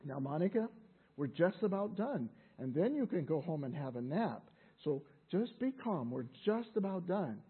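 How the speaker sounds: soft, low, soothing voice; calm patient mother. Retold or imitated. Mother at the checkout counter calming Monica, soft and patient. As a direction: quietly talk someone down. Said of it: "the mother said".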